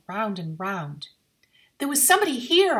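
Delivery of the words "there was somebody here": fast, loud and high insisting; insistent. A narrator's reading. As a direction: insist on it urgently. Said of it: "I insisted".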